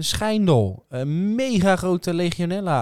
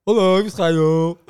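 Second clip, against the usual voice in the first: put-on Schijndel accent; 6 semitones higher than usual. Answering how Schijndel people talk, in a mock Schijndel accent. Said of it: "Hoe praten ze in Schijndel eigenlijk?".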